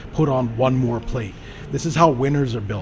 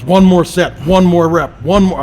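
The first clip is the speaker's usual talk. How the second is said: loud, higher coaxing shouts of one more; gym partners shouting; 6 semitones higher, 11 dB louder than usual. Retold or imitated. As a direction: yell encouragement across the gym floor. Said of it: "their training partners".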